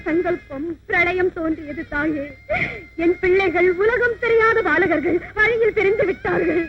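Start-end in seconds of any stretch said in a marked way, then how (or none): 3.0-6.6 put-on crying out in grief for lost children; a sobbing outburst; mother wailing to the goddess over her lost sons.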